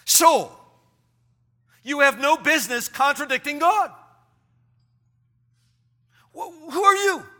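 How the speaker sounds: high, loud, challenging rebuke; stern self-sermon. Acted. Man preaching at his own soul for contradicting God, stern rebuke. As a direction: confront your soul forcefully, pausing between challenges. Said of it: "Now you continue your sermon".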